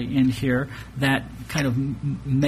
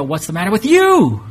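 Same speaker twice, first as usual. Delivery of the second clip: louder, higher, pushing hard on you; stress on you; 5 semitones higher, 7 dB louder than usual. Shown as a demonstration. Preacher shows a third inflection: you stressed. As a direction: lean on the final you and let it fall. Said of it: "emphasize elements of that a little bit differently".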